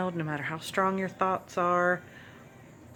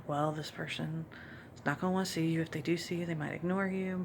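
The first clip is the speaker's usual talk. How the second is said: a softer, lightly voiced nagging doubt; hint of a sly inner critic, softer; 3 semitones lower, 12 dB quieter, faster than usual. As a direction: let the negative thought murmur at you quietly. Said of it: "some of those thoughts tried to come in".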